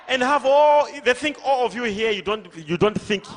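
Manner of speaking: loud, high-pitched pulpit challenge; full-force preaching. Real, not acted. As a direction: call out to the whole packed hall.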